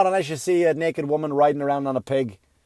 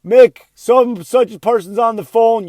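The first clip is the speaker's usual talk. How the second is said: loud, slow call up the stairs; calling upstairs; 7 semitones higher, 9 dB louder than usual. Retold or imitated. Mother calling son Mick to the phone, loud slow holler. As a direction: holler up to the kid's room.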